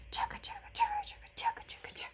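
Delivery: very high breathy chugging; whispered train chug. A narrator's reading.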